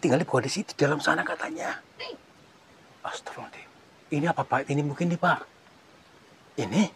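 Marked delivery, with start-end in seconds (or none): 3.1-3.7 breathy whispered astagfirullahaladzim; a shocked whisper at the sight; whispered prayer. Real, not acted. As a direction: murmur it in shock under your breath. Shocked at what he sees.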